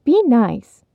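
sharp little reprimand; admonishing. A narrator's reading. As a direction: tell them off briskly. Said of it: "the welcoming voice admonished".

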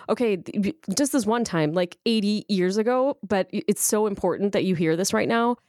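ordinary talk, nothing marked

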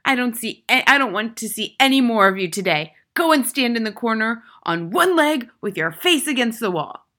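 loud, high, angry order; furious headmistress tirade; disgusted bellow. A narrator's reading. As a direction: shout the boy into the corner. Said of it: "Miss Trunchbull bellowed".

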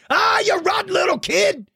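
high-pitched playful yell; mock outrage. Host to kid who drew him attacked, mock outraged yell. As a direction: cry out in hammed-up indignation.

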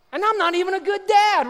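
high, loud protest; emphatic outburst. Real, not acted. Preacher confessing his fatherhood to the congregation, emphatic. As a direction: belt it out in comic indignation. Mock outburst about own parenting.

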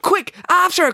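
high, loud call to give chase; urgent cry. Acted. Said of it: "cried April".

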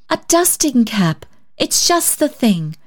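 slow touched voice. A narrator's reading. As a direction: savor the gift tenderly. Susan touched by gift.

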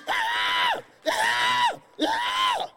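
high shrill-pitched panic cries of ah; panicked screams. Acted. Preacher acting out a traumatized person, frightened screaming. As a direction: scream in sudden terror, over and over.

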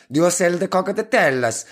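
hammed-up Italian accent, a bit slow; mock Italian accent. Shown as a demonstration. Ordering drinks at the bar in mock Italian accent. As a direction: put on a broad comic Italian accent. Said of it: "order your drinks in a northern Italian accent".

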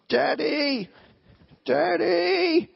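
put-on high, loud child calling for his dad; a stuck toddler calling out for help; imitating a little kid crying out Daddy; little boy calling. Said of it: "he'll be going".

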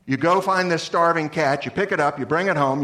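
ordinary talk

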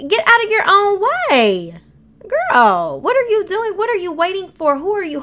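high-pitched sassy telling-off; exasperated scolding. Acted. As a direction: shake some sense into her, high and insistent. Exasperated address to an imagined girl.

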